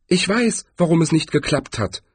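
high-pitched female character voice; voice from the crowd. A narrator's reading. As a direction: pipe up from behind a crowd. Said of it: "ertönte eine Stimme hinter".

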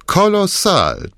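high, slow, savoring the word Kolossal; relishing. Acted. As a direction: stretch out Kolossal with delight. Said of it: "mit freudiger Miene".

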